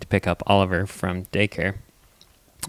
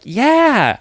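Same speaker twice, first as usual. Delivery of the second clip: put-on talking to toddler; 8 dB louder than usual. Parent answering toddler.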